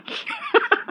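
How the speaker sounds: high burst of laughter; bright squeal of laughter. Real, not acted. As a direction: laugh high at your own joke. Laughs at own joke.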